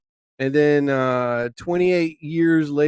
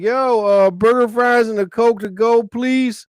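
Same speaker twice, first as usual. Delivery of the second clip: 1 loud drive-thru order; 7 semitones higher, 3 dB louder than usual. Retold or imitated. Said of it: "I was like".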